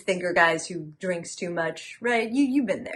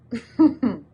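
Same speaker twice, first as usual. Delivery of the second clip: short louder, higher chuckle; laugh; 4 semitones higher, 6 dB louder than usual. Acted. Telling the knife story, amused laugh. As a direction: let out a quick chuckle.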